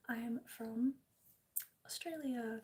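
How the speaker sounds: ordinary talk, nothing marked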